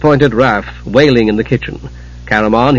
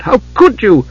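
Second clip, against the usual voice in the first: sharp, high burst of indignation; flaring up; 9 semitones higher than usual. Acted. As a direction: flare up at him. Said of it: "Tika flared".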